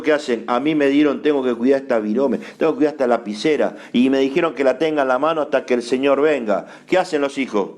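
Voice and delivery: ordinary delivery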